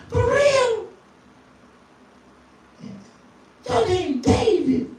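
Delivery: put-on high girl's voice. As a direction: ask like an impressed girl. Girl in retold dialogue.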